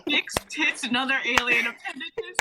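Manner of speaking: high, loud, delighted echo of the line; gleeful repeat. Real, not acted. Cast member gleefully quoting the script's line, loud and delighted. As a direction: savour the silly words with glee.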